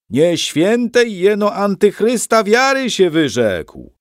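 slow, loud, high roar from the crowd; roaring heckle. A narrator's reading. Protestant heckler roaring at the stage, slow loud roar. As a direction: bellow it across the hall. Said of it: "ryknął".

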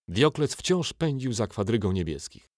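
usual speaking voice

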